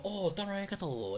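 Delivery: bow it out gently in Japanese; mock Japanese. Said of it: "I go".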